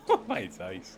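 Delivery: high laughing exclamation; giggling oh my days. Real, not acted. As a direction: laugh through it, breathless. Laughing at the name.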